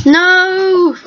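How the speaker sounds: sudden high no; a teammate dies, sharp no; dismayed cry. Real, not acted. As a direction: blurt it out in dismay. Reacting to teammate dying.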